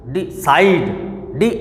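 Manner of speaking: Decide split as DI, then long SIDE; broken into syllables. Shown as a demonstration. Teacher showing two syllables, word broken apart. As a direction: separate DI from SIDE. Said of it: "दो है ना".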